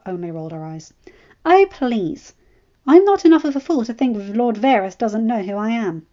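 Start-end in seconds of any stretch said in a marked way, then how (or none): 1.4-6.0 louder, higher, dismissive eye-roll tone; a touch of exasperated Ella; scoffing. A narrator's reading. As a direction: brush off the warning with a scoff.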